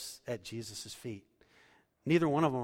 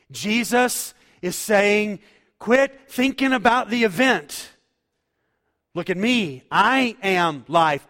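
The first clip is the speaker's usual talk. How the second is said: high, loud, stretched-out preaching cry; full-force preaching; 6 semitones higher, 14 dB louder than usual. Acted. Preacher proclaiming Jesus's claim to the congregation, loud and high. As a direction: lift the room, draw the key lines out slowly.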